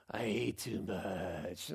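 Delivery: slow, low, drawn-out groan; imitated stuffed child. Daughter squatting in the store aisle, mock stuffed groan.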